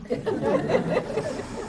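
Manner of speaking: breathy high laugh at her own joke; a quick breathy chuckle; light amused laugh; airy laugh. Real, not acted. Joke about round corners.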